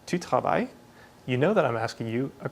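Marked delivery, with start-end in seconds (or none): 0.0-0.7 "Tu travailles?" with a clear rise on the end to make it a question; a plain statement turned into a question by its rising tune. Shown as a demonstration. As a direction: lift the pitch at the end of "Tu travailles?". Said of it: "show with the tone of my voice that it's a question".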